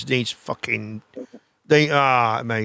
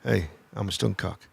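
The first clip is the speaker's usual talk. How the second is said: low smooth pickup line; 8 semitones lower, 9 dB quieter than usual. Retold or imitated. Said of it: "And then you go".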